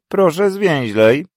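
high, loud, slow command; curt demand. Acted. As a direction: snap at the student. Professor demands brevity.

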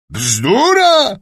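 high, loud outraged bzdura; old duke's outraged shout; indignant yell. A narrator's reading. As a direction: roar the word back in disbelief. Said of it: "wrzasnął książę".

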